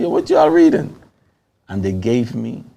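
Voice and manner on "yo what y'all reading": calling out. Retold or imitated. Calling out to the men reading.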